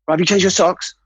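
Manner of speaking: high, put-on officer voice; boss on inspection. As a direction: check on the men as the boss. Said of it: "The boss comes around and goes".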